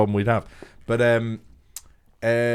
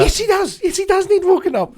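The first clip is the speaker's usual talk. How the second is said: high, louder indignant protest; 5 dB louder than usual. Real, not acted. Friend insisting the flatmate must be woken, loud and high.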